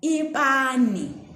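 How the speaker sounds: teacher models frying pan word; slow word demo. Shown as a demonstration. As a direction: stretch "ipani" for learners. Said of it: "Then we also have".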